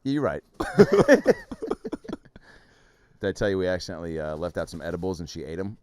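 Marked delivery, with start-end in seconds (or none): 0.5-2.4 loud, high burst of laughter. Real, not acted. Co-hosts joking about the dog, real high laughter.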